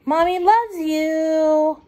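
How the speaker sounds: slow tender mother's words; tender whisper-like memory. Acted.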